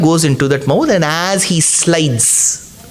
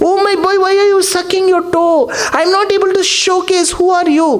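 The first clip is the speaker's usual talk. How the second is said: much higher, bewildered sage's voice; Markandeya baffled; 11 semitones higher than usual. Acted. Markandeya asking the baby Lord who he is, high and bewildered. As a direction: call out to the strange child in disbelief. Markandeya questions the sucking child.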